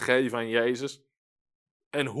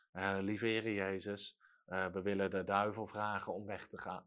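small, much softer and lower, timid; meek timid prayer; 6 semitones lower, 10 dB quieter than usual. Retold or imitated. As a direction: pray meekly as if afraid to disturb. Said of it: "je gaat niet zachtmoedig om met de duivel".

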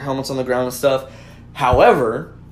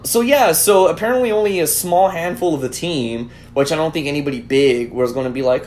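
ordinary talk

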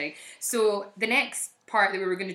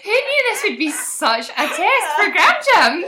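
high, louder, laughing; laughing tease; 12 semitones higher, 8 dB louder than usual. Real, not acted. Teasing her friend's reading, laughing. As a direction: poke fun through laughter.

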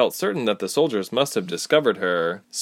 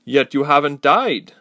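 hard, louder accusing line; steely; 6 semitones higher, 7 dB louder than usual. Acted. Warrior woman accusing Ilse, steely and louder. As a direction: cut at her with suspicion. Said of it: "with a steely glare".

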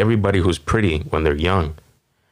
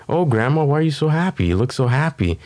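higher, sweet mock-cheerful voice; cheery grandchild; 7 semitones higher than usual. Grandchild greeting a happy grandma, sweetly higher. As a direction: lightly play the chipper grandkid. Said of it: "You're like".

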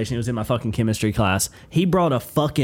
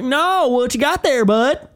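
high, loud, drawn-out challenge; coach laying into kid; 5 dB louder, slower than usual. Retold or imitated. Coach stops a student putting toys away, loud confrontation. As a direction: press the kid hard, voice pitched way up. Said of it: "starts laying into this kid".

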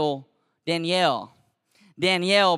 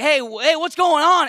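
loud, high demand; urgent raised-voice intervention; acted alarmed challenge; 9 semitones higher, 7 dB louder than usual. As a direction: burst in calling out over the noise. Bursts in on the fight.

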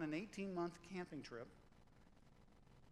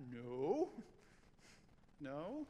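ordinary talk, nothing marked

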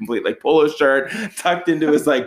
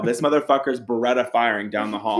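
normal talking voice

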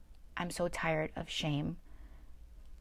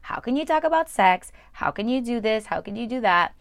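high, loud mock outrage; judgmental friends; 5 semitones higher, 8 dB louder than usual. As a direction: imitate a scandalised churchgoer.

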